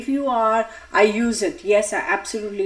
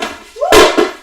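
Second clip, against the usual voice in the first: startled whoo; 11 dB louder than usual. Real, not acted. Startled mid-sentence by something.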